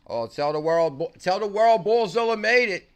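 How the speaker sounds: loud, high shout of joy; big excited announcement; hyped shout-out. Real, not acted. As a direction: shout it out to the whole world.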